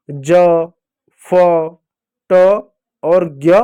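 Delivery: ज, फ, ट, ज्ञ each sounded alone with a pause between; reading letters aloud. Shown as a demonstration. Teacher showing children some letters, read one by one. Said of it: "इन अक्षरों को जरा पढ़ो".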